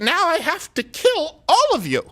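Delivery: high, slow, hammed-up threat; slow comic menace, voice pushed high; mock murderous threat. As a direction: play the wronged owner gone mad, half joking. Mock vengeful homeowner threat.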